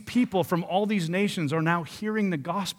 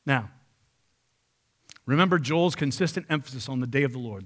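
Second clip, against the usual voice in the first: normal talking voice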